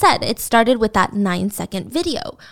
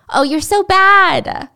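slow, higher, louder playful scolding; coy flirty tease; 9 semitones higher, 6 dB louder, slower than usual. Retold or imitated. Wanda scolding Mike playfully, slow and coy.

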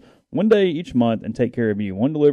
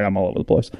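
ordinary delivery

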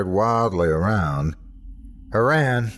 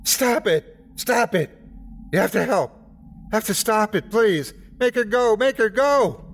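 high-pitched, frantic screamed plea; desperate scream; 12 semitones higher than usual. A narrator's reading. Drunk, crazed attacker begging an officer for help, screaming. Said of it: "as he screamed".

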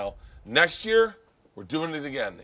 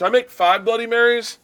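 calling out; 11 semitones higher, 11 dB louder than usual. Real, not acted. Calling out to the room.

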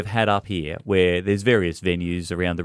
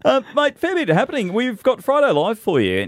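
excited; 13 semitones higher than usual. Acted. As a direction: pump up the segment. Excited show segment intro.

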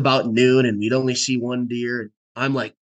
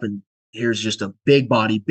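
ordinary talk, nothing marked

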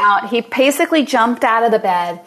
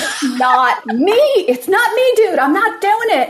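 laughing protest; 9 semitones higher than usual. Retold or imitated. Wife protesting to husband in bed.

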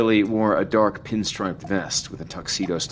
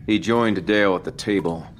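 ordinary delivery, nothing marked

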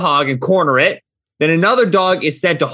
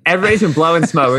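ordinary talk

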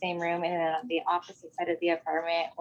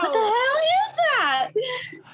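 startled shock; 5 dB louder, much slower than usual. Retold or imitated. Mom startled by the voice.

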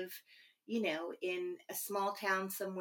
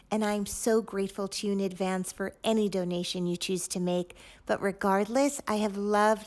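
podcast outro read; 6 dB louder than usual. Acted.

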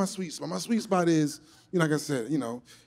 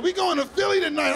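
put-on young party girl; 10 semitones higher, 3 dB louder than usual. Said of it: "One girl was like".

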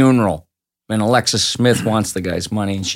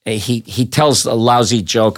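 usual speaking voice

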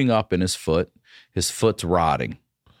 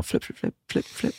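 quick unvoiced flip-flip noises; mouth-made flapping effect; imitated flapping; 8 semitones higher, faster than usual. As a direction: puff out each flip like something flapping. Imitates a mask flapping loose.

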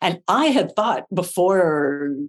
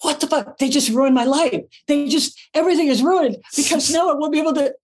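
hammed-up wailing despair, much higher; mock meltdown; 7 semitones higher than usual. Actress backstage hamming up her ruined-life wail. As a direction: wail as if life is over, played for laughs. Retelling meltdown over broken light.

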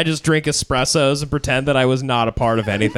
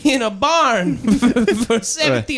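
high, hammy Italian voice; fake Italian accent; 10 semitones higher than usual. Italian character bit.

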